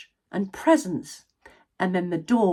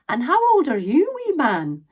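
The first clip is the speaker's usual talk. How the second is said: put-on elderly granny asking; 7 semitones higher, 4 dB louder than usual. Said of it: "looked down at him and asked".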